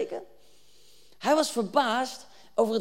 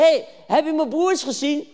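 loud, raised, acted call; 7 semitones higher, 8 dB louder than usual. David searching among soldiers for his brothers, calling out. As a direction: call out to men in a crowd.

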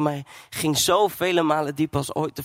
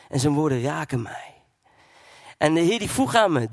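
normal talking voice

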